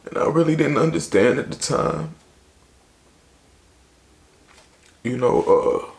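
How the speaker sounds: fighting back tears while telling it; tearful. Real, not acted. Voice strained after breaking down.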